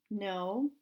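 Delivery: low, soft, timid answer; quiet, sheepish admission; small voice. A narrator's reading. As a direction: shrink the voice down to a meek reply. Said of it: "mine gets so small I can only whisper".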